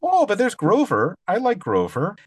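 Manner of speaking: put-on high, delighted child's voice; a little kid spotting Grover; excited kid. Host voicing a child reader who loves Grover, high and gleeful.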